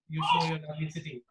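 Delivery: usual speaking voice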